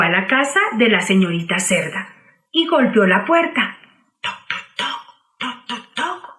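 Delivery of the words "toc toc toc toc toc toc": whispered knocking. Acted. Knocking at the door.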